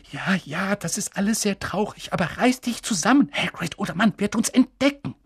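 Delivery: ordinary delivery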